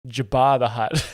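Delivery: Jabba as juh-BAH in "Jabba the Hutt"; supposed Canadian pronunciation. Shown as a demonstration. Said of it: "I think Canadians say Jabba".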